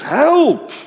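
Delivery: high, loud scream for help; cry for help. Retold or imitated. Drowning man overboard screaming loudly for help. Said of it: "En die het uitschreeuwt".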